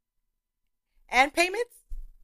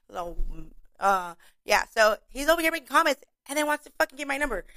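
ordinary talk, nothing marked

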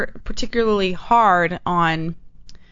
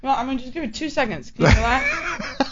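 talking while chewing; words through a bite of food; mouth full; 4 semitones higher than usual. Real, not acted. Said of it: "while you're eating".

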